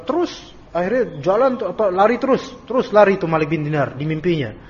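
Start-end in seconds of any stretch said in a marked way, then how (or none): none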